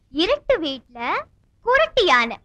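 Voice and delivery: slow, swinging riddle lilt; riddle posing. Acted. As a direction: pose it clearly, stretching each phrase. Poses the second riddle. (விடுகதை கேட்கும் இழுத்த தொனி)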